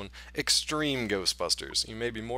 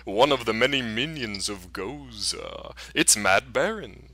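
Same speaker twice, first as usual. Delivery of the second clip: slow, hammed-up spooky announcer; slower than usual. Host introducing the third of an unholy trio, spooky voice. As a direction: stretch it out like a ghoulish master of ceremonies. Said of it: "I gotta put my spooky voice back on".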